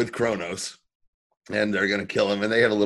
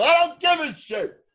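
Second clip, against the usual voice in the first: gruff dad shout; 5 dB louder than usual. Pretend. Playing mechanic dad.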